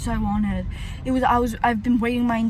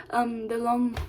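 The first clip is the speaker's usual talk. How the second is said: normal talking voice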